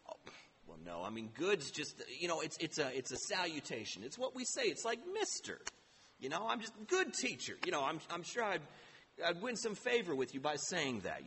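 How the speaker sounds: sheepish rich man fumbling for an excuse; flustered excuses. Acted. Rich young ruler backpedals to Jesus, flustered and low.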